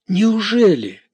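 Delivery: very high, loud cry. A narrator's reading. Theodore cannot believe she died, crying out. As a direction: stricken cry of disbelief. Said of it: "закричал Теодор".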